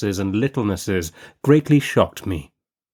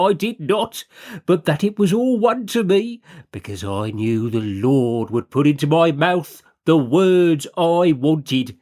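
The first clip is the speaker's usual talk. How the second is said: higher, fervent lay-preacher delivery; preacher's voice; 6 semitones higher than usual. A narrator's reading. Brother Hawkyard exhorting his fellow sinners, higher preaching voice. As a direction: preach it like a self-satisfied chapel expounder.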